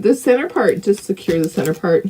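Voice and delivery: normal talking voice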